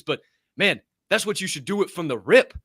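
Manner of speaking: ordinary talk, nothing marked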